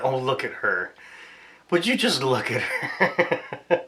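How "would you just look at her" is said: cooing at dog. Real, not acted.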